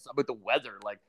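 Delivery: ordinary talk, nothing marked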